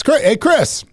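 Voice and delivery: high-pitched phone hail; mock friendly hail. Area supervisor takes the phone from new manager, high mock-friendly hail. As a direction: pitch it way up to greet the caller.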